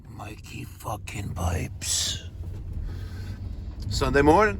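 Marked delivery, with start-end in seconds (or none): 0.1-2.2 breathy stage whisper of his own name. Acted. To his viewers, dramatic whispered self-introduction. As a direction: hush it like a secret intro.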